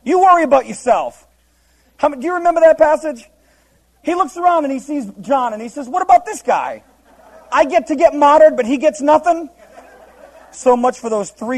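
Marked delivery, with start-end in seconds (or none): 0.0-1.2 mild scolding. Retold or imitated. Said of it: "Jesus gets mad at him". 5.9-9.5 much higher, louder aggrieved whine; indignant Peter. Retold or imitated. Peter complaining about John.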